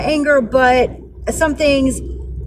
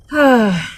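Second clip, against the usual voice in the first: voiced, falling sigh; weary sigh; 3 semitones lower than usual. Real, not acted. Venting after a bad day in court, weary sigh. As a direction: sigh it out, fed up. Sighs before moving on.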